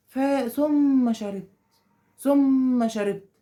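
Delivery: "ثم" drawn out very long, then "شربت"; teacher slowing right down to show slowness. Shown as a demonstration. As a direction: stretch "ثم" lazily to show a delay. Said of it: "في بطء".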